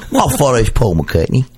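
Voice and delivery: lightly put-on chirpy celebrity intro; Stella McCartney impression. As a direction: introduce yourself like a breezy fashion designer. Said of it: "That's what Stella McCartney sounds like".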